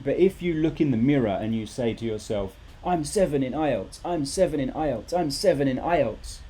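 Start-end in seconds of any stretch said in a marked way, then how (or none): 2.8-6.4 mock mantra. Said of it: "you're just a crazy person".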